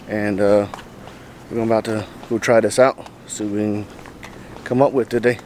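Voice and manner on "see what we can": normal talking voice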